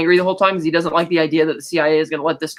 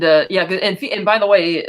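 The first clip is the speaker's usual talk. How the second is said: usual speaking voice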